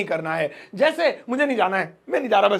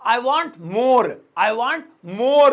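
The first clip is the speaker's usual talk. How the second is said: more shouted with a sudden leap; slow I want, then a loud burst on more; punched final more; 4 dB louder, much slower than usual. Shown as a demonstration. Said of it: "आपको बोलना है". ("more" ज़ोर से, अचानक ऊँची आवाज़ में)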